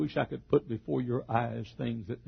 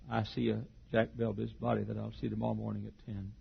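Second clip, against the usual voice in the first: usual speaking voice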